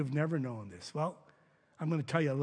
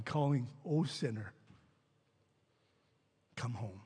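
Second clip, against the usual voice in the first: tender hymn recital. Acted. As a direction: recite it gently, like a lullaby of invitation.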